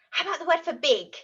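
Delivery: ordinary talk, nothing marked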